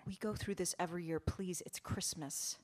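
quick, hushed plea from the wife; low voice. A narrator's reading. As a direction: plead under your breath, rushing it. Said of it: "in a voice too low to be heard in the back".

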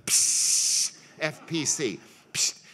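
hissed sizzle of a hot brand; mock branding-iron sizzle. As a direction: make the sizzle of a brand on hide. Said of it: "would brand them".

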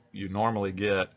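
ordinary talk, nothing marked

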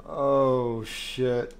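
ordinary talk

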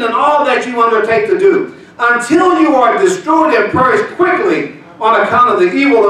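high, loud, slow with shouted words; shouted preaching. Acted. Pastor shouting the curses over the church. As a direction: hurl the curses at the congregation.

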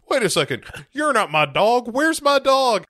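hammed-up rising protest, ending high and loud; mock outrage that climbs to a high shout; indignant demand. Neighbour confronting doll over missing dog, hammed-up indignation.

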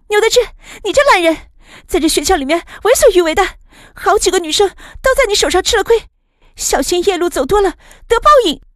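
high, loud scolding; loud accusation. A narrator's reading. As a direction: call out the bully in front of everyone. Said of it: "方心这时也大声道". (拔高嗓门大声斥责)

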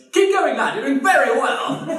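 high, booming heckle from the audience; loud voice. Retold or imitated. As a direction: bellow drunken encouragement at the stage. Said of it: "there is a loud voice that goes".

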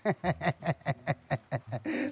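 soft, drawn-out sly laugh; a scheming villain's chuckle; villainous laugh. Acted. Landlord laughing after the woman escapes, villain chuckle.

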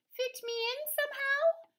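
very high sing-song sheep; pleading sheep. A narrator's reading. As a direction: bleat it sweetly.

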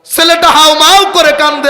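high, loud preacher's chant of the story; full-force preaching. Acted. Preacher tells the crowd how the son wailed, full force. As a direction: drive the narration to the crowd.

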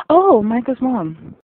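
loud, high, slow squeal of recognition; delighted recognition. Real, not acted. Viewer spotting Micah's mom on screen, delighted. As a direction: draw it out high and loud, thrilled.

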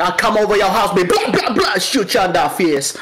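comic Jamaican patois, amused; Jamaican accent. Acted. Student threatening over homework, joking Jamaican accent. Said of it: "really good Jamaican accent".

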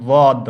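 বদ said loud and heavy, not like দাঁত; firm teacher model of বদ; correct dad demo. Shown as a demonstration. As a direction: stress the proper বদ sound for students. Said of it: "তবে উচ্চারণটা ঠিক নয়".

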